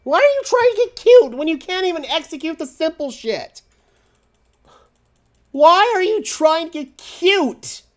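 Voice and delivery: high, loud, strained scream of disbelief; furious shout. Real, not acted. Fan screams at the defensive coordinator over the blunder, furious. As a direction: blast the coach for overthinking it.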